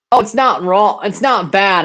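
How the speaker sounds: normal talking voice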